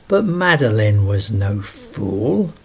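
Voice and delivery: ordinary delivery, nothing marked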